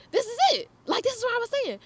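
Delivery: high-pitched, excited announcement; squealed-up quote of an eager moment; excited exclamation. Retold or imitated. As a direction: retell it like a thrilled discovery. Acts out triumphant moment at door.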